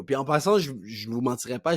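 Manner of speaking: ordinary talk, nothing marked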